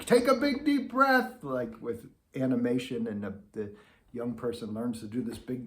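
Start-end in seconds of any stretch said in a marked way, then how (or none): 0.0-1.4 loud, high, hammed-up pep call; mock a band director pumping up the class; overanimated band director. Teacher imitating band directors, a loud high animated command. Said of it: "band directors saying".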